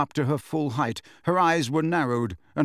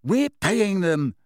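high, drawn-out indignant protest; indignant self-defence; 7 semitones higher, 3 dB louder, slower than usual. A narrator's reading. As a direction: protest slowly, pitched up and affronted. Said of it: "said Fred indignantly".

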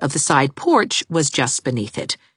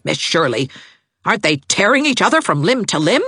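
put-on higher, pressing outrage over her child; indignant neighbour lady; 7 semitones higher than usual. A narrator's reading. Neighbour complains to governess about the twins, indignant. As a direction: demand it like an offended matron.

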